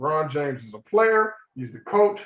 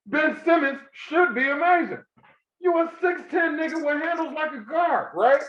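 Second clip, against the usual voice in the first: high, raised ranting voice; heated rant; 8 semitones higher than usual. Real, not acted. Heated rant about Ben Simmons.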